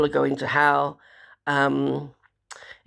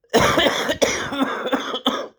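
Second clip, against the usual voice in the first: real wet chesty cough; 8 semitones higher than usual. Real, not acted. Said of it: "cough, mucus cough, chesty cough".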